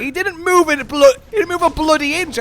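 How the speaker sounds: high, loud protest; indignant shout. Real, not acted. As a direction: protest it loudly. Indignant reply in game.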